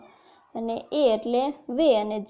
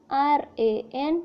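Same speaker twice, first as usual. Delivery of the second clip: slow spelling out; 2 semitones higher, slower than usual. Shown as a demonstration. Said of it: "એનું ઉચ્ચારણ થાય".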